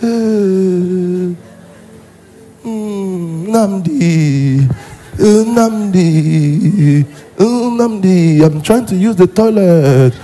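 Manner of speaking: slow, quavering moans with stretched endings; mock feeble old voice, groaning out the words; helpless old person. Old invalid calling Nnamdi for the toilet, helpless. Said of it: "You've seen yourself as a vegetable".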